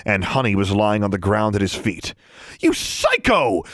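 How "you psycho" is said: high, drawn-out outraged cry; angry shout. A narrator's reading. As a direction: hurl it as a slow, high accusation. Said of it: "she shouted".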